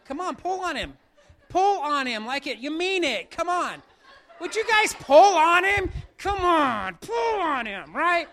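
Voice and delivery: loud laughing urging to pull harder; cheering the volunteers on, loud and laughing; urging shout. Real, not acted. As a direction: egg them on, shouting over the room.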